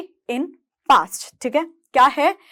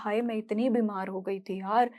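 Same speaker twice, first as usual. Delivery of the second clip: casual friend talk; 3 semitones lower, 9 dB quieter than usual. Pretend. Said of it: "अब मैं ये थोड़ी बोलूँगी".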